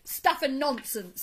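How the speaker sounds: loud, slow, dismissive retort; understated anger with weighty words; very angry. A narrator's reading. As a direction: scoff crossly at the challenge. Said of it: "very angrily".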